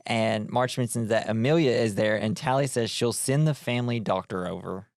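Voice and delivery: ordinary delivery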